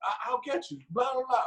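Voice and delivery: high, loud mock mother fretting; hammed-up anxious mother; imitating worried mom. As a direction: fuss over him like a worried mom. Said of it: "my mom is like".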